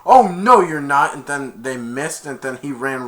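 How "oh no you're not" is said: loud, a little higher challenge; defiant shout. Retold or imitated. Defender shouting at the runner, loud. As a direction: block him with a yell. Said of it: "number twenty-two is like".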